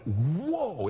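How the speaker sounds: reliving a jolt of amazement; retold amazement. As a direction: draw out a stunned whoa. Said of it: "And, and I was like".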